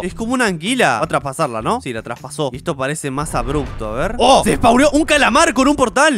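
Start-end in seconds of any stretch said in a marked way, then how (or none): 4.2-6.2 high, loud burst of surprise; excited amazement. Real, not acted. Gamer watching a squid spawn from a portal, amazed. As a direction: report it loudly, pitched up in astonishment.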